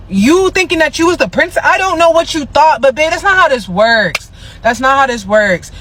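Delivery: high, indignant telling-off; exasperated scolding. Acted. Putting Tyla straight about being princess, high indignant scolding.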